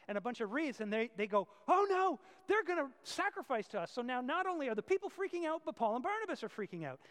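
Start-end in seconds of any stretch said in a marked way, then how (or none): 1.7-3.9 higher, much louder cry of alarm; sudden panic, the crowd means to worship them. Acted. Apostles realise the crowd will sacrifice to them, crying out in alarm.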